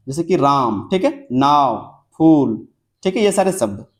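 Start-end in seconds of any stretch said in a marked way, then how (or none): 0.4-0.9 राम with a long, held AA; long vowel. Shown as a demonstration. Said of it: "उच्चारण में अधिक समय लगता है". 1.3-2.0 नाव stretched out slowly; long vowel. Shown as a demonstration. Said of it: "उच्चारण में अधिक समय लगता है". 2.2-2.7 फूल with a long, held OO; long vowel. Shown as a demonstration. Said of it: "उच्चारण में अधिक समय लगता है".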